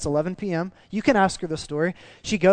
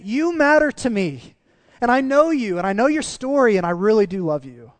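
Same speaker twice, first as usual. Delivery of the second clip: God's tender voice; 5 semitones higher, 5 dB louder than usual. Acted. God voiced speaking to one person.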